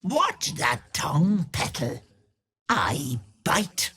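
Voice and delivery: teasing crone voice, sing-song and sweet; honeyed menace of a hag; sly old hag. Acted. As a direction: purr it like a wicked old witch. Hag character lines.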